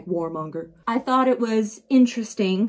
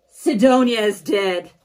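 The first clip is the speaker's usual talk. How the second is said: loud, stretched-out cry; dramatic announcement; 7 dB louder, slower than usual. Acted. Storyteller opens the recap with shocking news, dramatic. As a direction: announce the death loudly, with drama.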